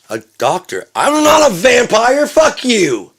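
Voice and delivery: slow, high, full-volume yell; hammed-up shrieking protest; outraged shouting. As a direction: scream an indignant denial. Acted outraged reply.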